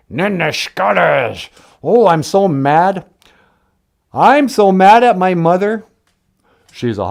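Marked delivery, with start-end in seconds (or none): 0.1-1.5 slow, tight mock-mad growl; through gritted teeth. Shown as a demonstration. Teacher shows how to say "I'm mad", through gritted teeth. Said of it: "say between grit teeth". 1.8-5.8 loud, hammed-up exasperation; mock mad. Teacher acts out being mad at mother, mock-angry.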